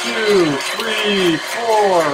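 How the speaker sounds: counting loudly over the blender. Real, not acted. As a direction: call each number out, high and loud. Counting aloud over running blender.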